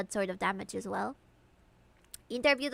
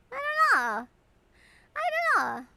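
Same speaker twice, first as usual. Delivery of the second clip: high put-on voice; 13 semitones higher, 4 dB louder than usual. Voicing partner character.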